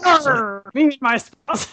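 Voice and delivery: hammed-up pirate growl that breaks into a laugh; a quick mock pirate "Arr" before giving up. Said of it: "you have to read it like a pirate".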